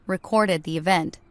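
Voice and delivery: plain reading voice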